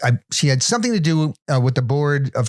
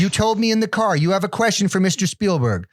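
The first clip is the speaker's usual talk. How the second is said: insistent aunt; 7 semitones higher than usual. Retold or imitated. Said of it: "And she goes".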